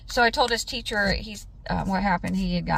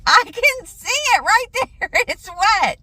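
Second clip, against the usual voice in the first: exasperated mom; 12 semitones higher, 7 dB louder than usual. Retold or imitated. Mom insists, higher.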